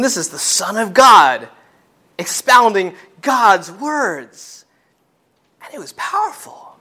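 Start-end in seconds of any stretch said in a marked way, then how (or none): 0.0-4.6 slowing right down on expounding God's words; slow emphatic proclaiming. Real, not acted. Preacher to congregation about the Son of God, slowed and emphatic. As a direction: stretch each word with weight.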